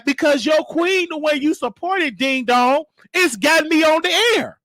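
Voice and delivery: ordinary talk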